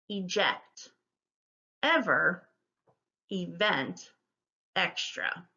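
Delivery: each word set apart by a pause; unhurried, separated word-list reading; careful word modelling. Shown as a demonstration. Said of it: "I'll go through it once".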